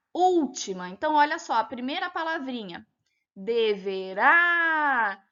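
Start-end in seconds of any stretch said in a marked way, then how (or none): 3.4-5.2 "deverá" with the last syllable RÁ loud and long drawn out; stress on final RÁ. Shown as a demonstration. Teacher showing pupils the stressed syllable, stress on RÁ in "deverá". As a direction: stretch out and punch the RÁ in "deverá". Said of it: "A sílaba pronunciada com mais intensidade é o rá".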